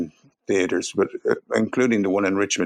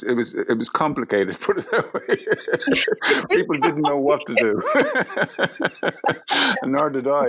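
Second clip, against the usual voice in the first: laughing; 7 semitones higher, slower than usual. Real, not acted. Awkward laughing recollection.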